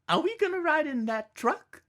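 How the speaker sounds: put-on high, wide-eyed little boy. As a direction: ask it like a curious little kid. Child voiced in memoir.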